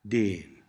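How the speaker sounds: déan with a long, continental e. Shown as a demonstration. Tutor models Cornish for learners, déan with long e. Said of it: "notice the long e sound".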